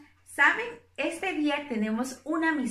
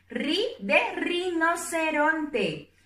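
slow teaching demo; 3 semitones higher, slower than usual. Shown as a demonstration. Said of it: "Yo les voy a mostrar ahora una con ri".

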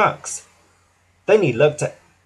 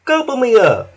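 higher, louder playful offer; kind Hank; 9 semitones higher, 5 dB louder than usual. A narrator's reading. Hank offering help, playful and high. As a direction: invite him to hand it over. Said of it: "Hank whistled kindly".

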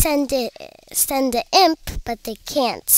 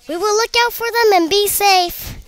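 high, raised voice; calling out; 10 semitones higher, 7 dB louder than usual. A narrator's reading. Pea shooters assuring their mom, calling out. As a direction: call it out loudly to someone. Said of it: "called the three little pea shooters".

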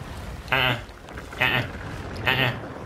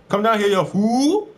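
taunting yell; 11 semitones higher, 3 dB louder than usual. Real, not acted. Calling out to enemies.

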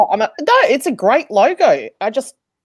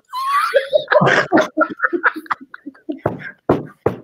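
shrieking laugh. Real, not acted. Cracking up at chat comment.

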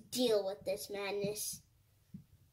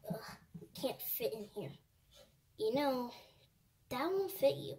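usual speaking voice

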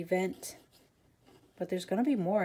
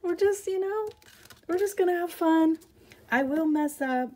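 much higher and louder, bubbling over; giddy excitement; 10 semitones higher, 6 dB louder than usual. Real, not acted. Crafter telling viewers she will teach live, with giddy excitement. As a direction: squeal it like you can't contain the thrill.

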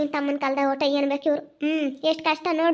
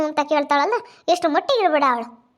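loud, raised-pitch outburst; indignant rant; 6 dB louder than usual. Real, not acted. Complaining about a son and his lover, indignant. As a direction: rail loudly in real indignation.

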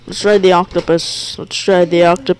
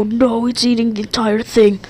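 ordinary talk, nothing marked

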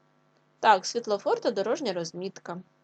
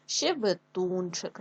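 slow щебетунчик, stretched on у; drawn-out stressed у in щебетунчик; slower than usual. Shown as a demonstration. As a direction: dictate щебетунчик by syllables, leaning on тун. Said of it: "наголос на у".

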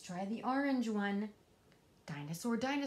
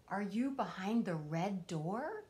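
plain reading voice

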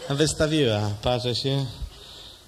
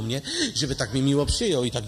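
ordinary talk

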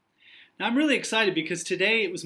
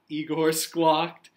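ordinary delivery

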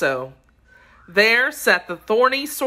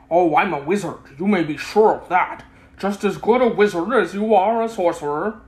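lightly voiced confident old showman; the Wizard boasting to the sorcerer, lightly voiced; boastful Wizard; 3 semitones higher, 3 dB louder than usual. A narrator's reading. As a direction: puff up a little like a circus humbug.

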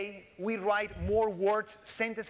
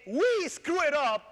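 slow, loud, higher mock admission; mock confession; 7 semitones higher, 5 dB louder, much slower than usual. Shown as a demonstration. Joking to the audience, a loud mock confession. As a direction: drag it out like a sheepish joke. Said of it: "when I said".

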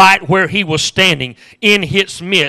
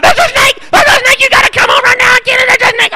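shrill high screaming, fast and frantic; panicked wife; 5 dB louder, faster than usual. Retold or imitated. Wife on the phone screaming about a snake in the yard, panicked. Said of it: "And I finally got out".

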